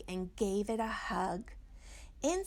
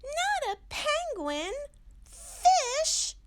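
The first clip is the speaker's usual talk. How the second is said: very high and louder squeals of disgust; Little Owl grossed out; 7 dB louder, slower than usual. Acted. Little Owl refusing fish for dinner, high disgusted squeal. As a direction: shriek it like a picky little kid.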